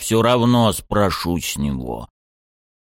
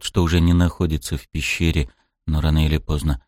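plain reading voice

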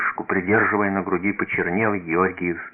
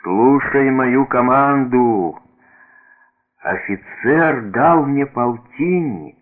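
slow, raised, drawn-out order; effortful military proclamation; dying soldier's command; 5 semitones higher, 4 dB louder, slower than usual. A narrator's reading. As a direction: labour through a final order, stretching the words. Dying soldier gives last orders.

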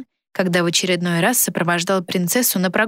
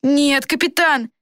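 put-on high, drawn-out boyish protest; flustered boy; 8 semitones higher, slower than usual. A narrator's reading. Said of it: "заикался Флорин". (растерянный высокий голос мальчишки)

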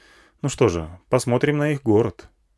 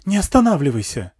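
higher, pleading order; alarmed woman's voice, pitched up; urgent woman; 5 semitones higher, 4 dB louder than usual. A narrator's reading. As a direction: urge the driver not to stop. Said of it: "затрясла меня за рукав женщина".

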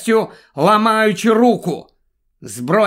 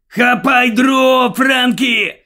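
high, loud yelled command; grandpa's shout; 6 semitones higher, 4 dB louder than usual. A narrator's reading. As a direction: yell it urgently to an accomplice. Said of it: "кричить дідусь".

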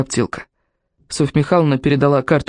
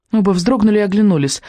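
ordinary delivery

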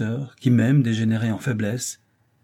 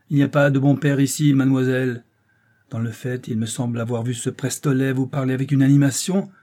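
angry interrogation; 3 semitones higher, 4 dB louder than usual. Acted. Father raging at his daughter.

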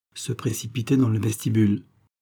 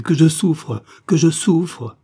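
loud, higher wailing moan; the burned baron cries out his suffering; agonized cry; 6 semitones higher, 7 dB louder, slower than usual. Acted. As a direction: wail out the pain twice. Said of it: "ces hurlements".

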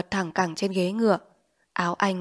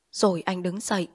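ordinary delivery

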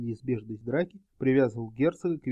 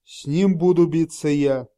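heated; 8 dB louder than usual. A narrator's reading. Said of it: "горячился фон Шлиппенштейн".

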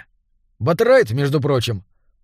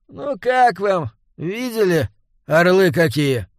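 sarcastic enthusiasm; 4 semitones higher, slower than usual. A narrator's reading. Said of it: "кислая мина и тон ... восторг не был искренним".

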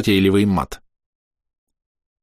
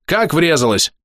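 higher-pitched alarmed question; restrained narrated yell, pitched up; yelled disbelief; 7 semitones higher, slower than usual. A narrator's reading. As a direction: demand it in shocked disbelief. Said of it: "завопил я".

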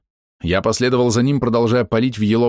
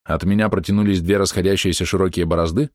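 usual speaking voice

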